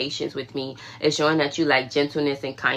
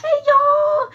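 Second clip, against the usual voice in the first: very high, loud, beaming greeting to everyone; overjoyed happy person; 4 dB louder, slower than usual. Retold or imitated. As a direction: squeal a giddy hello like the happiest stranger on the street. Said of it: "You ever see those happy people".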